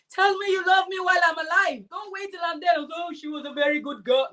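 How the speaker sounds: lightly voiced chat reader. Voicing viewers' chat comments.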